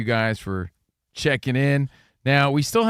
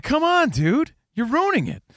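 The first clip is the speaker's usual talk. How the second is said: mock exasperation; 13 semitones higher than usual. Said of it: "I'm like".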